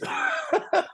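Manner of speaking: squealing high laugh; real high-pitched laugh. Real, not acted. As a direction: burst out in a giddy laugh. Laugh alone after joke.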